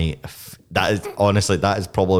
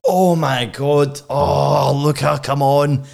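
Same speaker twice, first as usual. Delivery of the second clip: high, drawn-out dismay; appalled at a friend's ignorance; real exasperated disbelief; 7 semitones higher, 4 dB louder, much slower than usual. Real, not acted. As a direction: protest slowly and loudly in disbelief. Reacts to co-host not seeing Anchorman.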